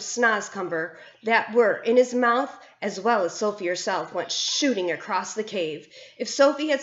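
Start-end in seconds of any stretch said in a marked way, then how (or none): none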